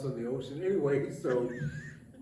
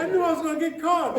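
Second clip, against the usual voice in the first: laughing quote; 12 semitones higher, 5 dB louder, faster than usual. Retold or imitated. Quoting the prisoner, laughing.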